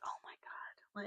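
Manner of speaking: breathless, hushed "oh my God" under the breath; exasperated whisper. Retold or imitated. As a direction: whisper it like a worn-out mom. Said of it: "And I'm like".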